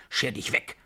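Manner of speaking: angry hiss. A narrator's reading. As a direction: send him away in suppressed anger. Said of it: "flüsterte sie zornig". (zornig zischend)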